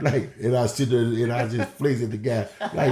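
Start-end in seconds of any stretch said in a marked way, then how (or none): none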